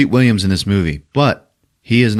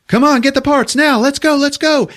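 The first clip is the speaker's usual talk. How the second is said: high, louder urgent rush; 4 dB louder than usual. Retold or imitated. Podcaster voicing Treat hurrying to escape, urgent. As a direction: hurry them along in a high voice.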